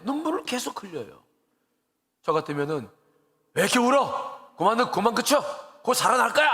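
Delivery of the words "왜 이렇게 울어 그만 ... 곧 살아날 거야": raised, higher voice, scolding the mourners to stop crying; a pretend loud telling-off to quit weeping; mock shout. Pastor imagining himself yelling at mourners to stop, mock shout. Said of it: "소리 지를 텐데".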